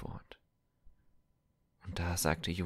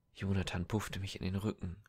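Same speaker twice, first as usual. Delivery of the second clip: ordinary delivery, nothing marked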